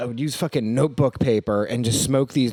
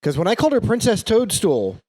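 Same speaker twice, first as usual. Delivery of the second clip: ordinary delivery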